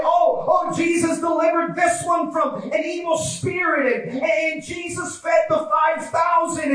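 high, fervent preacher's retelling; excited testifying. Acted. Blind men swapping stories of Jesus, high-pitched excitement. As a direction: marvel aloud at each miracle.

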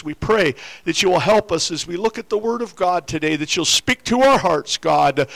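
low, reverent address to God; spoken prayer. Real, not acted. Opening prayer over the congregation.

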